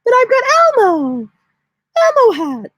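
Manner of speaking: high Elmo-style whine; squeaky Elmo. Retold or imitated. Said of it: "You're like".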